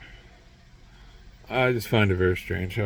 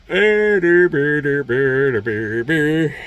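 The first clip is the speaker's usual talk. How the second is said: loud, higher dopey der ber babble; mock gibberish; 4 semitones higher, 8 dB louder, much slower than usual. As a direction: blurt nonsense sounds to mock them. Mocking babble after elites quote.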